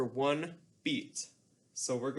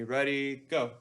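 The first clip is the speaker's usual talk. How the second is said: normal talking voice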